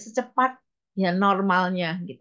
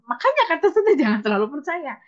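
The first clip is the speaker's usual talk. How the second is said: laughing it off, higher and louder; 7 semitones higher, 5 dB louder than usual. Real, not acted. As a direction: chuckle through the words. Laughs at her own pride.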